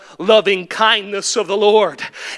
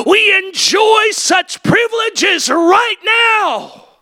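full-force preaching; 9 semitones higher than usual. Acted. Preacher shouting the believers' authority to the church. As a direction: climb with every right now. Building right-now refrain.

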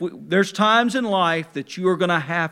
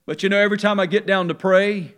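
ordinary talk

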